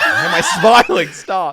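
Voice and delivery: giggling, then a laughing shout of stop; laughing at himself. Retold or imitated. Smiling at a funeral, he scolds himself through laughter. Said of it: "I'm like".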